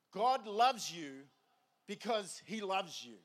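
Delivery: half speed, weighing each word; slow and deliberate. Real, not acted. Preacher wants the congregation to think about this, slow and deliberate. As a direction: let every word land, very slowly. Repeats it slowly for emphasis.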